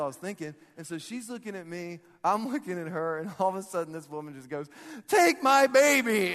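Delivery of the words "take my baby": high, very loud, drawn-out command; hammed-up desperate shout; frantic shouted order. Mom to passing jogger, imitated frantic shout. Mom urgently hands stranger her infant.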